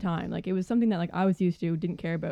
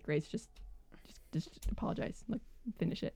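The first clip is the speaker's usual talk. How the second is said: much softer, half-whispered plea; hushed urging; 13 dB quieter than usual. Retold or imitated. Supervisor privately urging employee to apologise, hushed urging.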